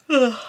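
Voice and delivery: a loud, high yawning groan; big yawn. Real, not acted. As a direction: let a real tired yawn escape. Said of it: "yawning away".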